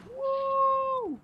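long, loud, very high "wooh"; high whoop. Real, not acted. Player making a little jump, high loud whoop. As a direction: whoop loudly as you take off.

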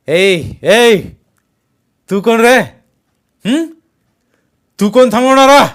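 high, very loud heckle; aggressive shouted challenge. A narrator's reading. Masked man challenges Jaydeep for stopping them, shouting angrily. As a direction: call him out and challenge his right.

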